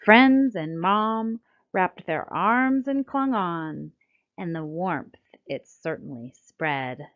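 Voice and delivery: lively, sing-song storybook reading; reading to children. A narrator's reading. Narrator reading a rhyming picture book to children, lively and sing-song. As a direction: read it like bedtime rhyme time for kids.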